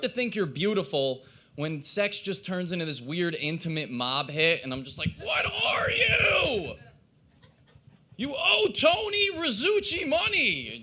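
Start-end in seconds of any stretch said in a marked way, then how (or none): 5.2-10.8 very high, drawn-out shout; hammed-up high mob-hit yell; mobster threat. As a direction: play a mob enforcer screaming for the debt. Acts mob hit during sex.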